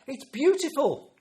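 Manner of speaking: high, loud, drawn-out mock delight; exaggerated grandparent enthusiasm; gushing praise. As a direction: gush over a small child's artwork. Grandad gushing over child's messy craft.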